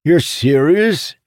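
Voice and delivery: loud, high, drawn-out disbelief; astonished. Acted. Jacob checking that Amandal means it, astonished. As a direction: stretch the question out, pitched right up.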